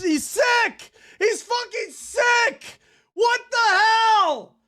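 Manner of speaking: high, loud, horrified yelling at the screen; real outrage, shouting out the disbelief; stretched-out shocked yells of what the fuck. Real, not acted. Shocked reaction to a disturbing photo.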